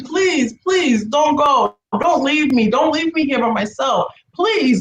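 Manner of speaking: high, insistent retold begging; desperate pleading. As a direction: plead over and over, pitch lifted. Retold repeated desperate plea.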